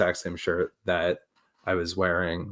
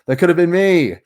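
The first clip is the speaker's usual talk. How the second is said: mock outrage; 9 semitones higher, 7 dB louder than usual. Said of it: "I was like".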